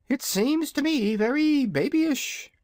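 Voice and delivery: high, slow dragon drawl. Acted. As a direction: stretch it out lazily, high-pitched. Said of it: "drawled the dragonette".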